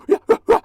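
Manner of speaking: very high, loud shrieking cackle; zombie shriek laugh. Pretend. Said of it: "my zombie impression".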